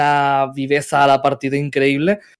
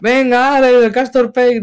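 higher, mock cheering call; fans egging on; 8 semitones higher, 4 dB louder than usual. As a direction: hype him up like a fan in the chat. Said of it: "la gente con los memes".

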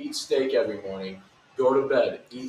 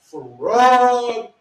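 loud drawn-out accusation; 8 semitones higher, 12 dB louder, much slower than usual. Pretend. Teasing friend, calls him fraud.

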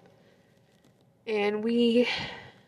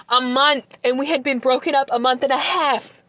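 raised, louder outrage; indignant disbelief; 4 semitones higher, 4 dB louder than usual. Real, not acted. Telling viewers about the ex moving on, indignant. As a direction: hammer out the timeline, higher and indignant. Outraged at how short it was.

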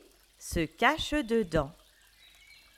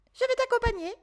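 rabbit character; 13 semitones higher, much faster than usual. A narrator's reading. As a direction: play the surprised rabbit. Rabbit voice in story.

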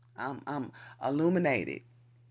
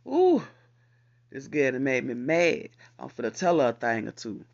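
a touch of retold irritation, louder; mock annoyed; 9 dB louder than usual. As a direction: act out a huffy girl, half-joking. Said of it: "Say, for instance, like".

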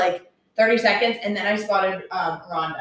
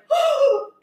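shocked gasp. Retold or imitated. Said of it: "looked at each other, like".

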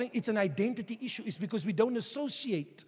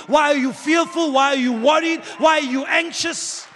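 full-force preaching; 5 semitones higher, 11 dB louder than usual. Real, not acted. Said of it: "preaching at full force".

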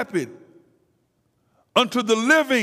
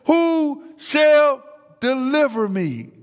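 slow, raised and louder cry of anguish; 6 semitones higher, 3 dB louder, slower than usual. Acted. Paul cries out to God, slow anguished loud cry. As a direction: call it out slowly, like a man begging for rescue. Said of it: "is crying out".

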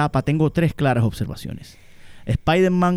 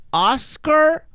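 mock grand; 3 semitones higher, 4 dB louder, much slower than usual. Shown as a demonstration. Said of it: "La considero una".

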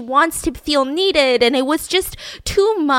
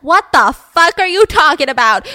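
loud, higher-pitched acted outburst; outraged disbelief; 5 semitones higher, 8 dB louder than usual. Mother demands answers from praising townsfolk, loud outrage. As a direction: burst out in mock outrage, punching the words. Mother repeats her baffled reply, louder.

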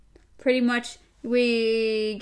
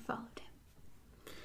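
whispered narration; 21 dB quieter, much slower than usual. A narrator's reading. Reading tense stealthy moment.

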